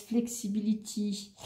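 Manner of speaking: put-on slow, playful English accent. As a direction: show off a drawn-out English accent. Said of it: "vous avez vu mon bel accent".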